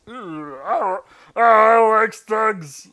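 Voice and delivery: drawn-out high, loud groan of dismay; dismayed officer. Acted. Officer spotting enemy tanks, slow loud groaning dismay. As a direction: wail the line slowly, dreading tanks.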